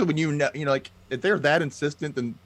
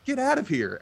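high squawked brush-off; mock dismissive bully; 13 semitones higher than usual. Bully brushing off the grandmother, high mocking sneer.

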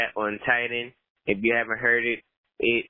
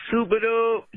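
catchphrase call; 11 semitones higher, 3 dB louder than usual. Real, not acted. Caller greeting catchphrase.

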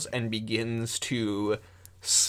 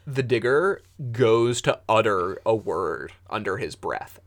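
ordinary talk

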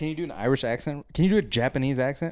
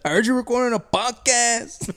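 hammed-up South Central Mexican homie, calling out; South Central Mexican accent; 10 semitones higher than usual. Showing off an accent for the co-host, South Central Mexican. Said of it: "That was a South Central Mexican".

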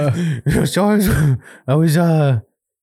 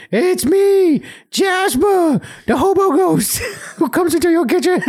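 high, laughing hobo ghost voice; lightly voiced hobo ghost; 10 semitones higher than usual. Telling friends the made-up ghost story, high laughing hobo ghost. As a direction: ham up a goofy ghost intro.

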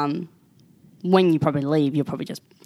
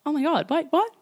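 much higher, rising disbelief, voice cracking; shocked panic. Retold or imitated. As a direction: squeak it out like the news just hit you. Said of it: "I was not expecting it".

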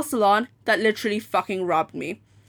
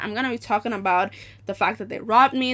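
ordinary talk, nothing marked

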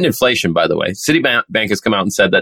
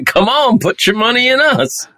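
pushy bank voice. Pretend. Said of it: "That sounds like something a bank would say".

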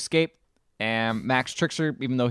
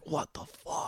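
whispered disbelief; 15 dB quieter than usual. Retold or imitated. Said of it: "it's just like".

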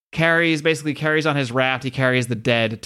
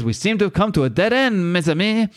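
lightly acted French accent, pitched up; 6 semitones higher than usual. Frenchie telling his friends they are stuck, French accent. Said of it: "Frenchie says".

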